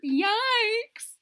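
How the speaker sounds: high, startled yikes; mock alarm at the picture. Real, not acted. Reader reacts to the picture of the pliers.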